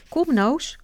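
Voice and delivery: put-on children calling a friend to come. A narrator's reading. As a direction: call them over, bright and loud. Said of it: "roepen ze".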